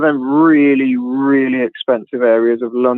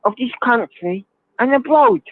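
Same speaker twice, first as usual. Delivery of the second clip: mock Russell Brand, whining about the poor; Russell Brand impression; 8 semitones higher than usual. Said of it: "that's really good impression".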